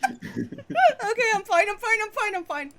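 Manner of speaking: breathless through laughter; giggling while insisting fine; laughing. Real, not acted. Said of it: "laughing fit, recovering".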